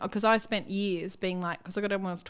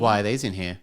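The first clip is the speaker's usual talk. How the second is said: much lower gruff husband voice; mock husband; 11 semitones lower, 5 dB louder than usual. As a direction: drop low and play the puzzled husband. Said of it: "He goes".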